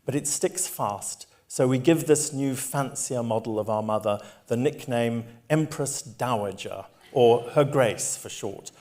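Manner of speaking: a lively, engaged reading of her own book; reading aloud. A narrator's reading. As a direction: read the passage to the listener with relish. Reads a book passage aloud.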